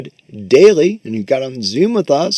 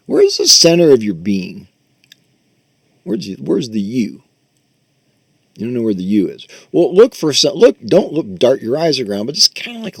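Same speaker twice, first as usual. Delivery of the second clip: guided meditation; 4 dB louder than usual. Acted. Teacher guides students to look for the self, meditation voice. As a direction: lead them inward, gently probing.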